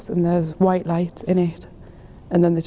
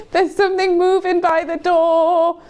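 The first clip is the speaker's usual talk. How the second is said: higher, panicked warning; 8 semitones higher than usual. Real, not acted. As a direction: voice climbing with fear. Rising panic about the door.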